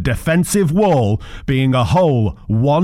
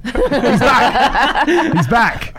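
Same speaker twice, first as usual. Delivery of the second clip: excited cheer; 14 semitones higher, much slower than usual. Real, not acted. Cheering a comeback.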